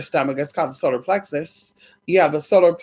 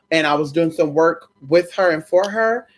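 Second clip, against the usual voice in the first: ordinary delivery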